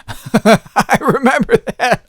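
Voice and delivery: laughing while speaking slowly. Real, not acted. Realizing his mother went through this too, laughing.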